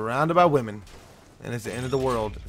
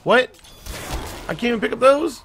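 high loud disbelief; shocked outburst; surprised; 11 semitones higher, 6 dB louder than usual. Real, not acted. Surprised he can't pick up.

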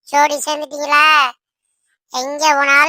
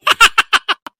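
high, loud burst of laughter; cackling laugh; 6 semitones higher, 7 dB louder than usual. Acted. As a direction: let out a sharp cackle. Laughing after scolding the kids.

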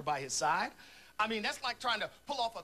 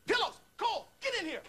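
calling out; 14 semitones higher than usual. Acted. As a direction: summon him from the next room. Calling a colleague into the room.

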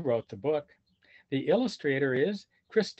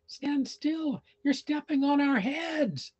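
much higher, squeaky chick voices; little heron chicks complaining; annoyed sibling chicks; 11 semitones higher, slower than usual. Acted. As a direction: scold him like cranky little sisters. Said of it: "His brother and sister said".

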